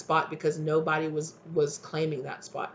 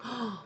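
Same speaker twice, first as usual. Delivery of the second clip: sharp gasp; 6 semitones higher, 9 dB quieter than usual. Real, not acted. Sees card, reacts.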